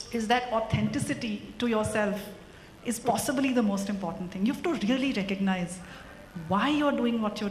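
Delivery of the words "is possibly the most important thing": ordinary delivery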